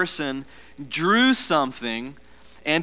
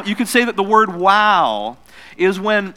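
ordinary delivery

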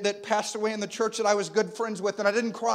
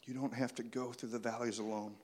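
soft preaching; 9 semitones lower, 13 dB quieter than usual. Acted. Intimate soft sermon passage.

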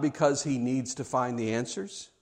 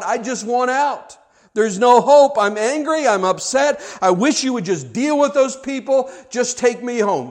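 loud, high, anguished outburst; angry despair; 7 semitones higher, 8 dB louder than usual. Acted. Elijah telling God he wants out, loud and upset. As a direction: let the frustration burst out. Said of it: "So he says to God".

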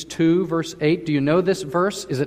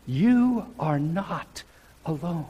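"you are not alone" drawn out very slowly, softer on "alone"; slow, quiet emphasis; slower than usual. Shown as a demonstration. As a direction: linger on each word of "you are not alone", then let "alone" fall soft. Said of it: "Even if you feel alone".

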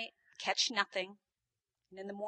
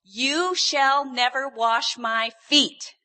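loud, drawn-out refusal with raised pitch; persistent protest; 3 semitones higher, 13 dB louder, much slower than usual. Retold or imitated. Peter refusing to let Jesus wash his feet, loud protest. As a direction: refuse loudly, stretching every word. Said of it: "But Peter persists".

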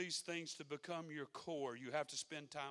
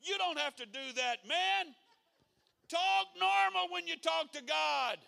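preaching at full force; 16 dB louder than usual. Acted. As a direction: shout the point home.

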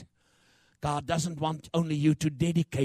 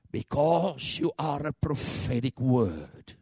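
ordinary talk, nothing marked

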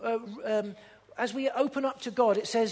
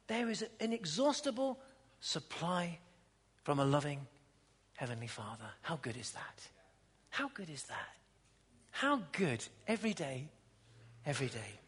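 much softer, lower, tender; hushed preaching; 5 semitones lower, 9 dB quieter than usual. Acted. Pastor softly comforting congregation, tender hush. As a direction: draw them close quietly.

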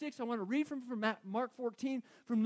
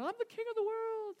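much higher, jubilant movie-bow cry; Titanic shout impression; 13 semitones higher than usual. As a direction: throw it out like the Titanic bow scene. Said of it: "with his arms open wide going".

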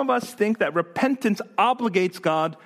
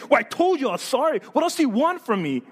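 higher-pitched, acted exasperation; angry, raised voice; 7 semitones higher than usual. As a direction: snap back like a cornered apologizer. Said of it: "they become angry and they raise their voice".

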